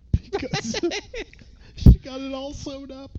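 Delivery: high, breaking up with laughter; laughing through words. Real, not acted. Co-host cracking up at own joke, laughing hard. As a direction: struggle to finish the punchline through giggles.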